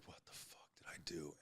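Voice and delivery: a hushed, disbelieving whisper; stunned whisper. Retold or imitated. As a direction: whisper it to yourself, horrified. Retold panic after overdose.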